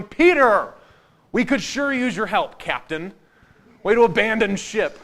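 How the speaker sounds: hammed-up complaint shouted across the water; calling out, exasperated. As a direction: call after a deserting crewmate. Disciples calling after Peter from boat.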